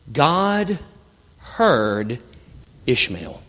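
low, weighty, word by word; long pauses between words, dropping low and soft; solemn emphasis. Acted. Preacher telling that God heard Ishmael, solemn.

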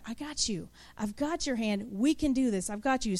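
almost hushed, as God holding your hand; tender reassurance. Acted.

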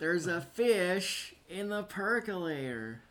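high, very slow character impression; Jack Nance. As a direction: draw the film line right out. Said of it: "Jack Nance".

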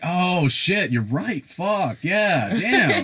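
high, loud sudden epiphany; hammed-up overjoyed eureka; mock excited realization. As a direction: act like one compliment fixed everything. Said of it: "You should be like".

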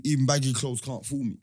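usual speaking voice

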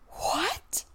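high, rising disbelieving what; surprised what. Retold or imitated. Retelling the movie moment, a stunned what. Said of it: "I said aloud".

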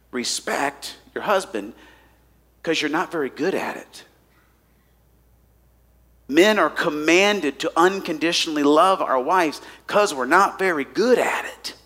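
normal talking voice

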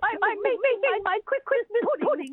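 high, stammering British nanny in a panic; flustered mock-English housekeeper voice; Missus Doubtfire. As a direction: fuss in a panicky put-on British accent. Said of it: "sounds like you're in a panic".

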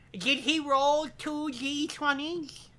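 high, slow nerdy voice; mocking nerd voice. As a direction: tease him like a dorky gamer. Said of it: "I just mocked you".

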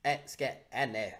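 low, quiet, knowing cartoon voice; Cartman. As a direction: confide it softly and slyly in a cartoon voice. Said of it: "And Cartman's like".

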